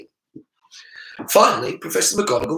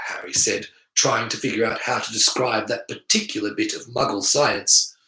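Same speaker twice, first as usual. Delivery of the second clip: ordinary delivery, nothing marked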